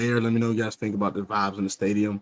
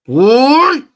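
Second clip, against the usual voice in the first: shout; 14 dB louder than usual. Real, not acted. Trash talking Cowboys fans, a loud high yell. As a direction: bellow it at rival fans.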